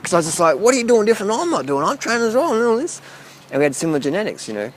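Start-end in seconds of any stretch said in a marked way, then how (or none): 0.6-3.0 higher-pitched imitation of himself nagging; retold pestering. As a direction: replay your own pestering questions, pitched up. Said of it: "I was just harassed him all day".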